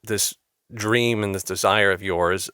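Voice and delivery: normal talking voice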